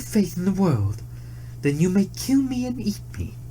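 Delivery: high, urgent plea; desperate deer. A narrator's reading. Deer begging the tiger to wait, high desperate plea. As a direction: beg him to wait, voice lifted. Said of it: "But the deer cried".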